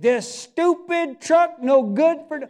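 high, loud, slow mock disgust at the truck; hammed-up grumble about a broken truck; mimicking the same owner now fed up with his truck, mock anger; grumbling owner. Mock annoyed owner after clink.

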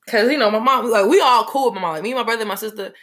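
ordinary delivery

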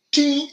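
a high, bright imitated ting of the club; high club ting sound. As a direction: sing out a high ting like the club hitting the ball. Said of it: "he was just like".

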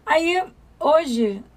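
normal talking voice

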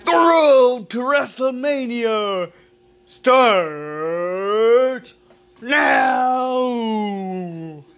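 booming wrestling announcer promo; big ring announcer hype voice; dramatic reality show hype. Acted. Wrestling event promo intro.

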